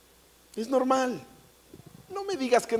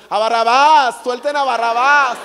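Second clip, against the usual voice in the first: loud demanding yell; crowd shout; 5 semitones higher, 12 dB louder than usual. Retold or imitated. Woman in the crowd screaming for Barrabás, loud.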